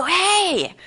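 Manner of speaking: high, drawn-out party guy call; little party guy. Acted. Party guy popping up in the speaker's head, high playful call. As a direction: pipe up as a tiny excitable pest. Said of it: "this little party guy who lived in my head".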